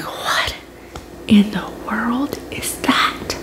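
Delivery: hushed, frightened whisper; retold whisper. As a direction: whisper it in disbelief at the pain.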